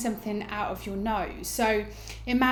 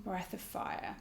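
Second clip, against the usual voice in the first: guided meditation; 2 semitones lower, 12 dB quieter than usual. Acted. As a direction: ease them into stillness. Guides breathing practice.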